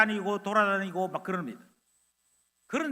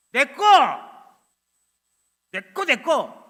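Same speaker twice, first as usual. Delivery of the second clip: put-on possessive child; 4 semitones higher, 9 dB louder, slower than usual. Child claims toy.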